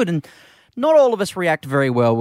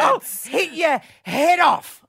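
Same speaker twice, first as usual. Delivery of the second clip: slow, hammed-up shouted threat, higher; mock shout; 10 semitones higher, slower than usual. Host mimicking his wife yelling at the old lady, mock shouting. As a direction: yell it slowly like a furious mum. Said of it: "all she could come up with".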